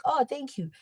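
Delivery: high-pitched, airy 'oh' of thanks; mock-polite retold thanks; unbothered thanks. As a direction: brush it off with a sweet thank-you. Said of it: "I'll be like".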